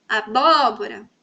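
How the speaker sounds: Abóbora, slowly, with a long BÓ; slow demo of aBÓbora, second syllable held; stress on BÓ. Shown as a demonstration. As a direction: stretch out the BÓ in Abóbora. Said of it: "se eu falar, também consigo identificar".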